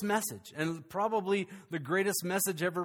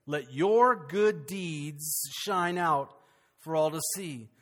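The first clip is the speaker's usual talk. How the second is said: slow, deliberate reading; 3 semitones lower, slower than usual. Acted.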